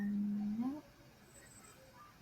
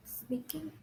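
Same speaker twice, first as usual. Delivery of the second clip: barely whispered, unsure; shy whisper; 5 semitones higher, 14 dB quieter than usual. Real, not acted. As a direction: breathe the answer out shyly. Shy student answering teacher.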